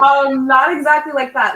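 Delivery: laughing through the words; giggling out an answer. Real, not acted. Embarrassed laugh while answering.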